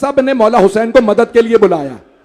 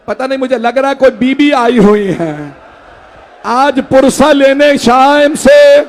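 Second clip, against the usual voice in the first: shouted mourning recitation; 4 semitones higher, 5 dB louder than usual. Acted. Lament continues to shout.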